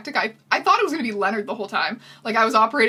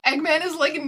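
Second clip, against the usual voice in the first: normal talking voice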